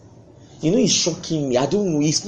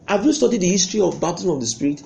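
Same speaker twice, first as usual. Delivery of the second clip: usual speaking voice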